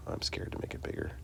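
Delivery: a whispered aside to himself. Real, not acted. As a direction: whisper it, as if only to yourself. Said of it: "aside to himself".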